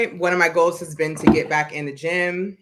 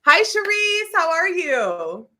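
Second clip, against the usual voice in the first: excited greeting; 9 semitones higher, 3 dB louder, slower than usual. Real, not acted. Greets a viewer in chat.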